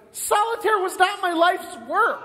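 high, loud, acted outrage; indignant protest. Dead man objecting to the angel's verdict, indignant shout.

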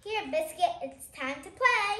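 usual speaking voice

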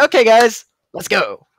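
rally the group loudly; loud rallying call. Acted. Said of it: "I say".

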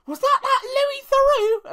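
high, slow imitation of a gullible cleaner; cleaner's clueless question. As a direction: put on her voice, high and drawn out. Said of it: "And her response was".

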